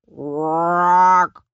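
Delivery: long, loud frog croak; monster croak. A narrator's reading. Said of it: "machte das Ungetüm". (lautes, langgezogenes Quaken)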